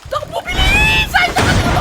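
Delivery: frantic run call; panicked shout. Real, not acted. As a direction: shout as if the tank is right behind. Fleeing a tank in game.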